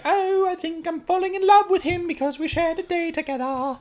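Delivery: high dreamy mock romance voice; swooning lovestruck lady. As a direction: gush it like a smitten heroine. Mocking Truly's swoony love scene.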